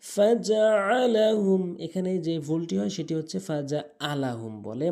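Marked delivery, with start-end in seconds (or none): 0.0-1.6 slow, melodic tajweed chant; Quran recitation. Shown as a demonstration. Teacher reciting the first verse for learners, measured Quran recitation. 3.5-4.5 "ফাজআলাহুম" with plain glottal hamza; hamza for ain. Shown as a demonstration. Teacher showing the mistake, "ফাজআলাহুম" with hamza replacing ain. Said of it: "আইনের জায়গায় হামজা এনে".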